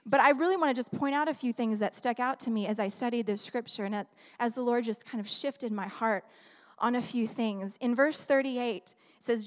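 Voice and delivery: normal talking voice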